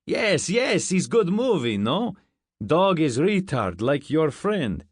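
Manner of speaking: put-on loud, high, excited Russian-accented guard; thick Russian accent. A narrator's reading. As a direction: enthuse in a heavy Russian accent. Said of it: "He had a thick Russian accent".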